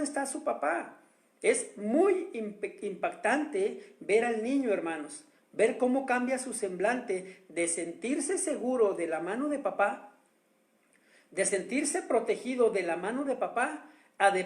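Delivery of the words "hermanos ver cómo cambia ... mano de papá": ordinary talk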